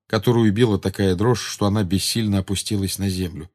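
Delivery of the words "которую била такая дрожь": usual speaking voice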